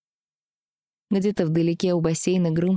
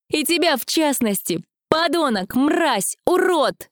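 much higher, loud enraged yelling; furious shout; 10 semitones higher, 4 dB louder, slower than usual. Acted. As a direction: spit out each insult, rising. Heroine hurls insults at Rastus.

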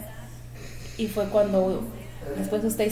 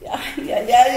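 laughing dismay; 3 semitones higher, 5 dB louder, much slower than usual. Retold or imitated. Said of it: "con ganas de echar para atrás".

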